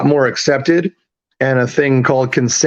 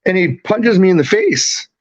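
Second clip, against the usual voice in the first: usual speaking voice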